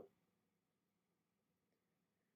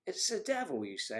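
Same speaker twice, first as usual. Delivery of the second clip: mock shock; 9 semitones higher than usual. Repeats mock-shocked retort.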